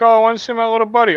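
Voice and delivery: high, quick mimicry of Chad; mocking imitation. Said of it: "and Chad's like".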